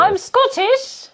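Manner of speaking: high, loud, slow fake Scottish accent; a silly put-on Scottish voice; mock Scottish. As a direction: ham up a Scottish brogue. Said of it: "That wasn't so far from Scottish".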